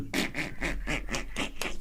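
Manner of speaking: breathless unvoiced wheeze laugh; wheezy laugh. Friend mimicking a wheezing laugh. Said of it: "as he laughs, he goes".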